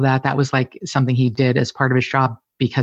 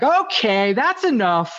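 higher, mock-flustered protest; embarrassed husband; 8 semitones higher, slower than usual. Husband embarrassed by his wife's joke, mock-flustered. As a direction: play the shy husband brushing off the joke. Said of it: "he would get really embarrassed and be like".

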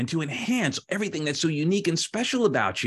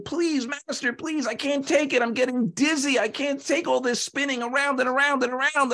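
screaming clay; 11 semitones higher than usual. Acted. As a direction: plead dizzily in a high, strained pitch. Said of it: "the clay is screaming".

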